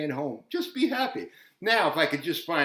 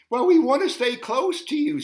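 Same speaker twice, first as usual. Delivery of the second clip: put-on child voice; 10 semitones higher, 5 dB louder than usual. Child pleads in story.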